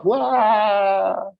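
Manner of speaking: high, drawn-out mock crying; long mock "waah"; imitated child's wail. Imitates child who keeps wailing.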